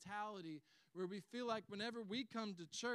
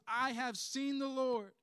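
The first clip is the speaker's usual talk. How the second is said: loud, slow proclamation; triumphant announcement; 4 semitones higher, 9 dB louder, slower than usual. Acted. Mary Magdalene telling the disciples, loud announcement. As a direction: ring it out loud and drawn out. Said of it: "went and announced to the disciples".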